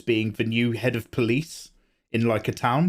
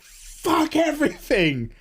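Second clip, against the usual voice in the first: high, slow, drawn-out cry of rage; mock despairing howl at the world; frustrated outburst; 13 semitones higher, slower than usual. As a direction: wail it out like the world's against you. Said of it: "I was just like".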